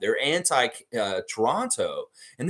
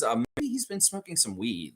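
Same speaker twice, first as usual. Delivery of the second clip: usual speaking voice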